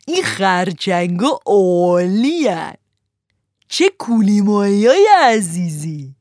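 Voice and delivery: slow, loud, relishing each fish; gloating fisherman. Acted. Green fisherman gloating over his fish, slow and loud. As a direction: savour every name like a treat.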